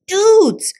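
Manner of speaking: high, loud excited shout; big high-pitched cry of greeting. A narrator's reading. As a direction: holler it out to your buddies. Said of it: "shouted Scapito".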